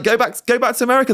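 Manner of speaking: high-pitched jokey send-off; mock rebuke. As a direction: playfully tell off a complaining newcomer. Repeats the mock rebuke to Americans.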